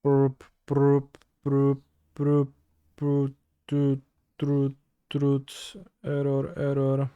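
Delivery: beeping like a broken machine; malfunctioning robot noises; glitching robot. Pretend. Mock machine noises after bad draw.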